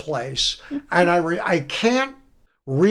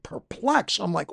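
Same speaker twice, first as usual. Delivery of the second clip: usual speaking voice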